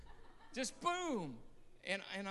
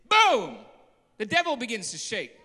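explosive louder boom; sudden shout; 3 semitones higher, 15 dB louder than usual. Acted. Preacher booms as God hits the button, loud. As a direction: hit it like a blow.

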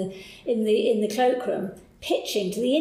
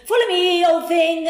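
louder, higher, brisk mock-posh boss call; breezy boss; 8 semitones higher, 8 dB louder than usual. Boss summoning researcher to follow, loud higher breezy call. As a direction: toss it over your shoulder like the busy boss. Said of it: "He'd say".

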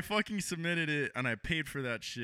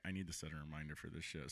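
muttered to himself; 11 semitones lower, 14 dB quieter than usual. Retold or imitated. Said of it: "I'm like".

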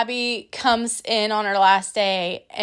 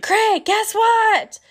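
excited call; 10 semitones higher, 4 dB louder, much slower than usual. Retold or imitated.

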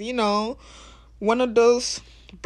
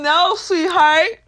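sassy retort; 6 semitones higher, 9 dB louder, much slower than usual. Acted. Retorting to conditioning.

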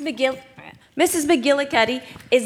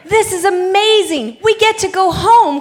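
laughing with joy, loud and high; excited; 5 semitones higher, 8 dB louder than usual. Acted. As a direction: burst out happy, laughing. Overjoyed at going home.

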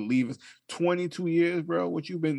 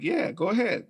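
higher, teasing go-ahead; playful dare; 11 semitones higher, 4 dB louder than usual. Retold or imitated. Father teasing his baby, playful higher dare. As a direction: dare the baby to keep going.

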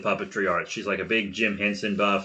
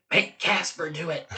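conspiratorial stage whisper, softer and higher; 5 semitones higher, 5 dB quieter than usual. Retold or imitated. Co-host whispering to the game master to volunteer Casper, whispered. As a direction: whisper it over on the sly. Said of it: "I would just whisper over to you".

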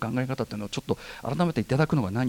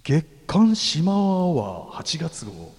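slow, eerie title reading; hushed creepy ghost story; much slower than usual. Acted. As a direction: read it like a horror story intro. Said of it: "こういう読みをする予定じゃなかったんだけど、ちゃんときっちりこういう感じになりましたね". (怖い話風のゆっくりした読み)

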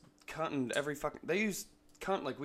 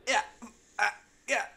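high, fumbling stammer; flustered coworker; 10 semitones higher, 8 dB louder, faster than usual. Retold or imitated. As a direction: stammer like you were caught off guard. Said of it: "It was just like".

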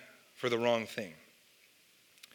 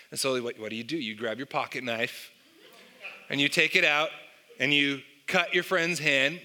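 ordinary talk, nothing marked

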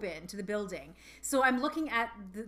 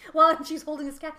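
ordinary talk

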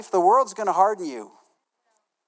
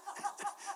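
quick soft laugh after his own line; laughing at his own confession; real laugh; 13 semitones higher, 17 dB quieter than usual. Real, not acted. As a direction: chuckle under the breath.

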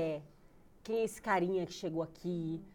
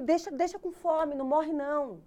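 high, loud, fed-up comeback; exasperated retort; 8 semitones higher, 7 dB louder than usual. Retold or imitated. Showering mother answers nagging grandmother, loud and exasperated. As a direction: shoot back loudly, out of patience.

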